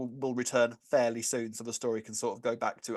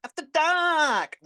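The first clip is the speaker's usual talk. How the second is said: shouted catchphrase; 7 dB louder, much slower than usual. Pretend. Show catchphrase called out.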